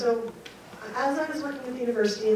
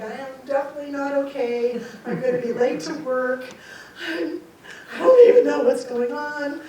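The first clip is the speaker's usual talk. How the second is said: louder, higher, rattled outburst; acted overwhelmed exasperation, climbing at the end; flustered protest; 5 semitones higher, 8 dB louder than usual. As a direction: build to a bewildered cry. Acts out rattled answer to husband.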